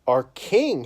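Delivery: loud, outraged wail of the brothers. A narrator's reading. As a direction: wail it out in protest. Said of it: "his brothers wailed".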